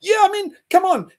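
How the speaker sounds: high, loud come on; scoffing outburst. Real, not acted. Agreeing with Ryan, scoffing loudly. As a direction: scoff at the pacifist story.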